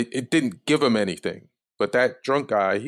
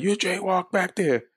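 high-pitched cop accusation at normal volume; mock yelling; 10 semitones higher than usual. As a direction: imitate an officer yelling. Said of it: "Like, they were yelling".

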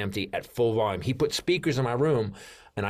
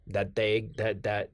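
usual speaking voice

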